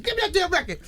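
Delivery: high, loud, urgent demand; excited demand. Retold or imitated. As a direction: demand it at once, fired up. Acted demand on hearing the hit.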